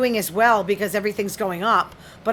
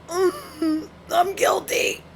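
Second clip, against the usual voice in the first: high, whiny fake cry; mock whimper; 8 semitones higher, much slower than usual. Admitting to social media stalking, mock-tearful whimper. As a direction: whimper it like a guilty child caught out.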